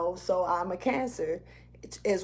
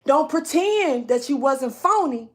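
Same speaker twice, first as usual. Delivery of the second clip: speaking strong; 3 semitones higher, 5 dB louder than usual. Real, not acted. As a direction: deliver it loud and high, stretching the key words. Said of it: "it's got me speaking strong right now".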